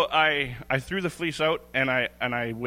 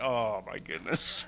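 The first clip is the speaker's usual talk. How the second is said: 1 awed; 3 semitones lower, 7 dB quieter, slower than usual. Retold or imitated. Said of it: "And I'm like".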